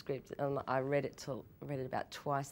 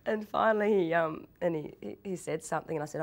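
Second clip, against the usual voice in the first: usual speaking voice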